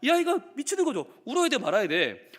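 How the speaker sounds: ordinary delivery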